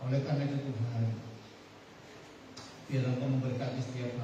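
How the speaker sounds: low, hushed praying voice; prayer. Acted. Pastor leads opening prayer.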